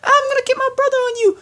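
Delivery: half-laughing mock telling-off that leaps way up high at the end; hammed-up scolding that cracks into a laugh, then a squeaky high threat; mock yell. Telling off an imagined girlfriend, a half-laughing mock yell. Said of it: "yell at her and say".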